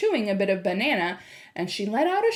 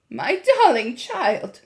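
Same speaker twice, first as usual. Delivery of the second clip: slow, raised-voice wonder; slowly; 4 semitones higher, 6 dB louder, slower than usual. A narrator's reading. Grandmother marvels at her grandchild, speaking slowly. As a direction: draw each word out, louder and higher. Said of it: "she said slowly".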